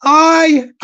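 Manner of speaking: mock shout. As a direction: start a pretend garden shout. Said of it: "in the garden and shout".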